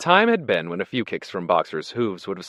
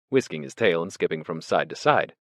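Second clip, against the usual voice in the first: plain reading voice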